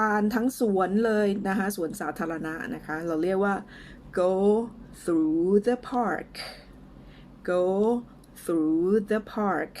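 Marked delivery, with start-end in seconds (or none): 4.2-9.7 word-by-word model for repeating, with pauses; slow and clear. Shown as a demonstration. English teacher models a phrase for learners, slow and clear. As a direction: say it slowly, each word apart. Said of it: "ช้าๆ ชัดๆ ไปก่อนนะคะ".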